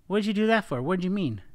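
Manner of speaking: loud, high, accusing demand; attacking. Shown as a demonstration. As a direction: fire the question off like an accusation. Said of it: "No, that's attacking".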